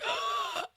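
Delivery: sharp breathless gasp in. Retold or imitated. Woman retelling waking from surgery, voiceless gasp. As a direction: imitate a desperate gasp for air.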